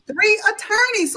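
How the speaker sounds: ordinary talk, nothing marked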